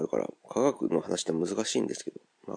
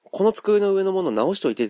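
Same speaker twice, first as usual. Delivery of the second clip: この机の上のもの直しといて said much higher as the example you don't say; wrong-usage example; 10 semitones higher, 3 dB louder than usual. Shown as a demonstration. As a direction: raise the voice as a quoted wrong example. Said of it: "とは言わないんですよ". (言わない例として高い声で)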